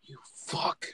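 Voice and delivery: strained voiceless mock curse; pained whisper. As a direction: curse him with no voice left. Acting out pained curse at friend.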